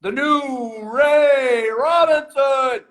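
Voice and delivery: slow, high boxing ring announcer; enunciating voice. Pretend. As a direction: draw out the fighter's name, over enunciating. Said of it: "I wanna try my enunciating voice".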